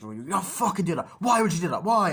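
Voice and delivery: loud, high-pitched acted outrage; hammed-up furious pleading shout; over-the-top angry yell. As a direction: yell it like a hammy film actor, building. Acts out over-the-top quarrel scene.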